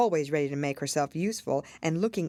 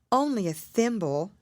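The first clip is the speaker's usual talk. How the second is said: sad; 6 semitones higher, 3 dB louder than usual. A narrator's reading. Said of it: "said Alice sadly".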